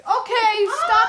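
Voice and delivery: loud laughing okay; laughing shout. Real, not acted. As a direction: yell it through laughter. Pan chaos, laughing.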